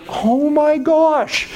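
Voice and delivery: high, slow, drawn-out gasp of awe; stunned awe. Retold or imitated. Recalling the Spirit's nudge, high slow awe. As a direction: let it hang, overwhelmed.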